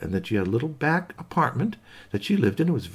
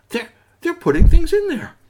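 higher, louder gasping alarm; 10 dB louder than usual. Acted. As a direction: gasp then high, loud alarm. Squeaky gasps in alarm.

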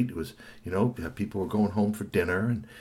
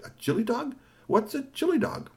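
puzzled; 14 semitones higher than usual. Acted. Boy asking what a chili dog is, high puzzled. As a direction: ask as if never heard of it. Kwame puzzled by unknown food.

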